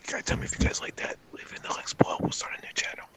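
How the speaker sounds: soft unvoiced YouTuber whisper; ASMR whisper. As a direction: whisper the channel sign-off.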